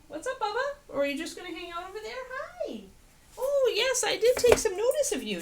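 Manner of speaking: high sing-song pet voice, fast at first; squeaky puppy-talk greeting. Real, not acted. Owner baby-talks to her dog.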